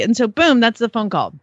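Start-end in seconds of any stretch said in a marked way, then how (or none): none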